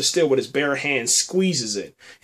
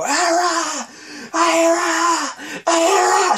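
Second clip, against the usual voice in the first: screaming a name; much slower than usual. Retold or imitated. Brienne calling for the hiding girl, imitated scream. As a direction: call out desperately into the woods. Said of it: "she starts screaming".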